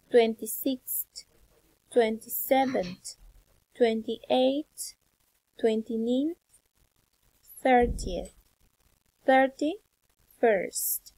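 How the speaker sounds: isolated words with long pauses; teacher leading a pronunciation drill; repeat-after-me drill. Shown as a demonstration. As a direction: model each ordinal firmly, then wait.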